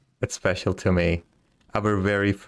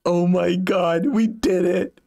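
high, loud, slow triumphant joy; overwhelmed with triumph; excited; 8 semitones higher, 3 dB louder, slower than usual. Real, not acted. Trophy pike finally caught.

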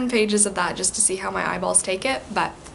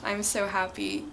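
ordinary talk

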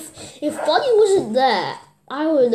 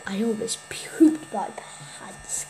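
whispering; 5 semitones lower, 7 dB quieter than usual. Real, not acted. Whispered confession.

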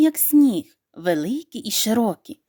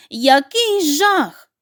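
crying out; 5 semitones higher, 4 dB louder, much slower than usual. A narrator's reading. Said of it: "заплакала мама качка".

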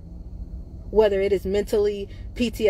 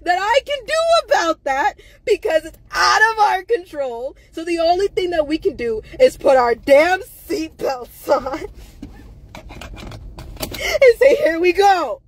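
high, loud, crying shout; furious, close to snapping; anger breaking into tears; real anger; 9 semitones higher, 4 dB louder than usual. Real, not acted. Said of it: "I'm fucking angry".

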